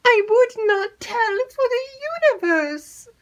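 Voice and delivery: frightened maid begging her mistress; crying out. Acted. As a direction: plead in alarm, voice cracking. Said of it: "cried Annette".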